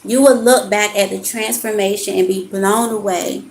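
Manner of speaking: measured, emphatic reading of card text. Acted. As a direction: read the card like a gentle sermon. Reads oracle card aloud.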